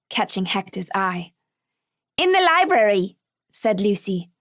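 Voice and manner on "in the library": put-on eager child. Lucy eager answer.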